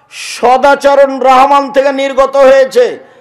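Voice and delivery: loud, high chanted hadith recitation; fiery preacher quoting Arabic; preaching at full force. Acted. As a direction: declaim the Prophet's words to the crowd.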